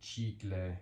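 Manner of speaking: "chicle" opening on a crisp English ch; ch sound. Shown as a demonstration. Teacher giving a ch example, "chicle" with an English ch sound. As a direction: say "chicle" as CHEE-kleh, softly. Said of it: "ch sound".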